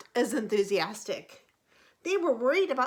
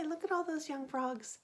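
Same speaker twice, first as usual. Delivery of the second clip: ordinary delivery, nothing marked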